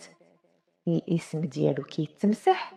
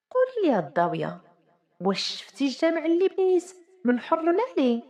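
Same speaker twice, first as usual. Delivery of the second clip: indignant sultan; 6 semitones higher than usual. A narrator's reading. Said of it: "وكيقول في سرو".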